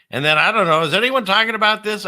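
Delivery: like you can't believe nobody else noticed; worked-up disbelief. Real, not acted. Incredulous aside to viewers.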